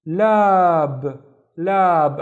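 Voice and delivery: lab loud with a long aa; isolated syllable. Shown as a demonstration. Teacher reading the second syllable, lab loud and drawn out. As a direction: hold the vowel of lab. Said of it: "the second syllable is".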